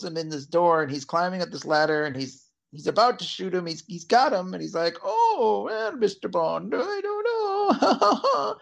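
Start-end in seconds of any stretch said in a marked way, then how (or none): 5.0-8.6 high, slow, laughing villain taunt; mock villain. Javier Bardem's villain taunting Mister Bond, high slow laugh. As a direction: tease Bond in a hammed-up high voice.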